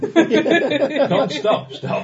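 high, loud laughing words. Real, not acted. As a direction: giggle through the words. Joking over telegram style.

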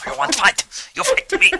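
high, loud hammy kung fu challenge; bad movie dubbing. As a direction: shout it like a badly dubbed fighter. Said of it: "You look like you're in a badly dubbed kung fu film".

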